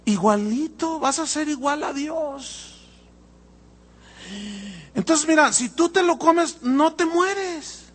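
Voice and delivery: high, coaxing sweet-talking serpent; smooth tempter voice. Retold or imitated. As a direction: lure her gently with sweet promises. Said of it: "Te voy a decir cómo habla el dragón".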